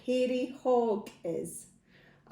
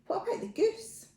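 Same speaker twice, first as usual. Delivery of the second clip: usual speaking voice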